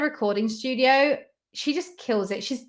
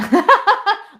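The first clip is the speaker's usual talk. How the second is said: laughing; 11 semitones higher, 10 dB louder than usual. Real, not acted. Laughing at viewer request.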